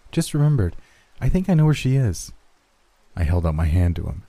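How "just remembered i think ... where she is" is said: put-on high, eager little girl; sudden excited child; bright child voice. Child tells father, suddenly eager.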